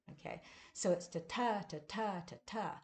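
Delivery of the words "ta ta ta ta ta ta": ta TA ta TA ta TA, weak then strong; quick rhythm demo; iambic rhythm. Shown as a demonstration. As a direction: tap out an iamb. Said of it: "That's an I am".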